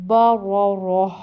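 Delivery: با را راہ loud, with a clear breathed h at the end; pausal h ending. Shown as a demonstration. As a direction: let با را راہ finish on an audible h. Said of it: "ہا کی آواز آئے گی".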